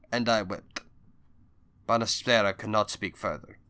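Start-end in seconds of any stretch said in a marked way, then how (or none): none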